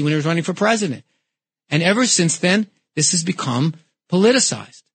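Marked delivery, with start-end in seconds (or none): none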